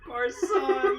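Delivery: high, cracking up on the words; helpless laughter. Real, not acted. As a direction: barely get it out while laughing. Cracking up at own joke.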